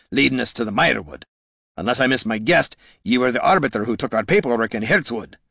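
firm dwarf voice with dialect; plain-dealing dwarf. Acted. Gorm to the Mask, frank dwarf voice. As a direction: speak as a dwarf confronting an old foe.

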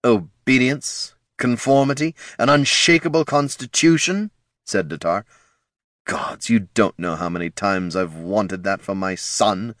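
lich swelling into a fervent cry; rising zealous lich. A narrator's reading. Datar voiced as the Lich.

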